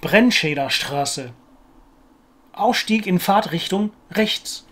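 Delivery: slow, careful German R's in "Brennscheder Straße" and "rechts"; German R lying down. Shown as a demonstration. Learner trying the German R in horizontal position, slow careful R's. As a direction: sound every R in "Ausstieg in Fahrtrichtung rechts", slowly and deliberately. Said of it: "I'm gonna try it now".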